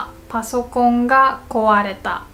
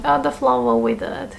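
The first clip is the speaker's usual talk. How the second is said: casual remark. Shown as a demonstration. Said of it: "I'm just saying".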